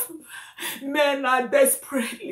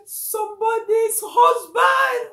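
loud, high wail; distraught; 6 semitones higher, 5 dB louder than usual. Real, not acted. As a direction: cry it out in grief. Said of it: "I'm just not happy".

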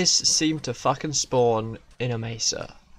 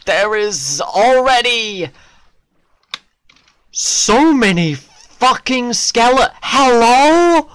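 slow, high, building exasperation; loud outraged disbelief; 8 semitones higher, 7 dB louder, slower than usual. Real, not acted. Player facing too many skeletons, rising outrage. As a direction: build to a loud, incredulous cry.

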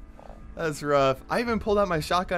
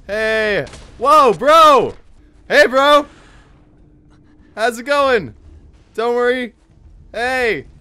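high-pitched, raised voice; nervous placating calls; 8 semitones higher, 7 dB louder, slower than usual. Real, not acted. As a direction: call out to calm an armed stranger. Pleading with an armed player.

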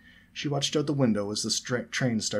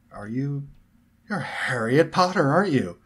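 awestruck; 6 semitones higher, 6 dB louder than usual. A narrator's reading. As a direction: gasp it out in awe. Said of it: "exclaimed Fred in awe".

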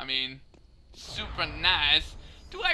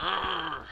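imitated growl; 5 dB quieter than usual. Heroine shows the face you don't want, mock growl. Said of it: "she's like".

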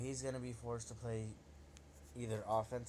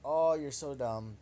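mocking taunt; 3 semitones higher, 9 dB louder than usual. Real, not acted. Mocking opponent's misplay.

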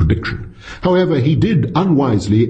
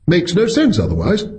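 higher, eager, pressing the point; eager pounce; 8 semitones higher than usual. A narrator's reading. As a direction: jump on the argument like catching a mistake. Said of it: "Wright pounced".

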